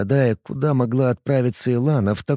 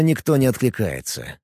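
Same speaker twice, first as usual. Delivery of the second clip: ordinary delivery, nothing marked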